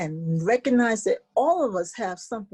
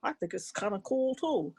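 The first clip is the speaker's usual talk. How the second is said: lower, softer, easygoing frog voice; 4 semitones lower, 6 dB quieter than usual. Acted. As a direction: speak low and mellow as the bullfrog. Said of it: "And the Frog said".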